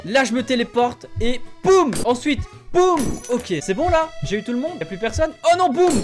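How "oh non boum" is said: loud, higher startled cry; startled yelp. Real, not acted. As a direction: jump at the sudden enemy. Sudden enemy, startled.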